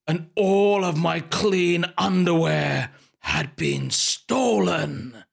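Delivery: slow, drawn-out mock horror; dramatic reveal. Guest telling of stolen underwear, melodramatic mock horror. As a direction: stretch it out like a dreadful revelation.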